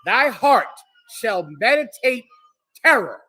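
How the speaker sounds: "Thy heart", "shall meditate", "terror" in separate punched chunks; emphatic verse repeat. Real, not acted. Preacher repeating the reader's verse, "terror" punched after a pause. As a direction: pause before "meditate" and "terror", hitting each.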